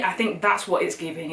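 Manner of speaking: normal talking voice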